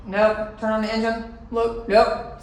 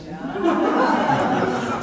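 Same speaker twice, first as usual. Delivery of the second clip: whispered rising laugh; breathy laugh; 7 semitones higher than usual. Real, not acted. Laughing about her therapy session, breathy laugh.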